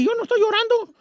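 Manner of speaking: put-on very high little-boy voice; imitating a tearful small boy; little kid denying tears. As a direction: squeak out a childish denial. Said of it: "Era como los chiquillos".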